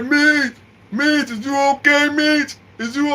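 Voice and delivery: high, drawn-out put-on cry; mock a wailing call through the wall; neighbour yelling, imitated. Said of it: "I thought he was yelling when he was".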